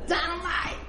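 high, hammed-up "Dynamite!"; mock-dramatic teacher exclamation; mock teacher shout. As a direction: belt it out like the teacher. Teacher announcing quiz, retold.

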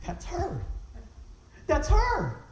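high, rising to a loud cry; excited outburst, building in volume; excited recognition. Retold or imitated. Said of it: "but excited".

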